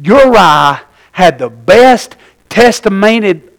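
ordinary talk, nothing marked

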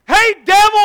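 loud, high call-out to the devil; mock challenge. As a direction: shout it like picking a fight. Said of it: "walk out and say".

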